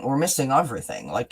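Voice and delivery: usual speaking voice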